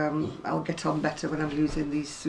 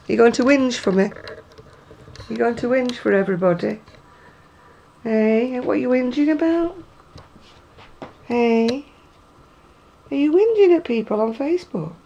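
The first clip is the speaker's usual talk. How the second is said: high pet-talk tease; 7 semitones higher, 8 dB louder than usual. Real, not acted. Owner teases whinging Buster on camera, high loud baby talk. Teasing the whining dog.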